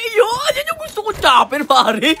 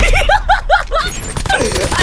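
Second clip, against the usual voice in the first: high shouted laugh; 14 semitones higher than usual. Real, not acted. Laughs at a wild kill.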